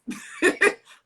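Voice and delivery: bright burst of real laughter, high and loud; sudden high laugh. Real, not acted. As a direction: laugh out loud at your own line. Burst of laughter mid story.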